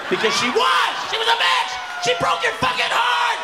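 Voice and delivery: furious rant. Real, not acted. Ranting at heartbroken man.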